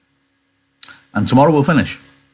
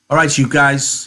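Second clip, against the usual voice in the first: higher, pushy call to the men; Curly rallying; 6 semitones higher than usual. Acted. Curly calls the ranch hands together, bossy.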